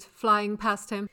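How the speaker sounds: ordinary delivery, nothing marked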